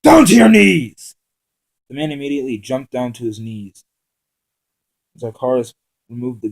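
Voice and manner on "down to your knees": much higher, much louder, slow barked command; furious command at gunpoint; snapped order. Acted. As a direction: bark the order at him. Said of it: "Zakaris snapped out".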